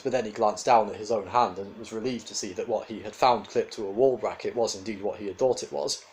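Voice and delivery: ordinary delivery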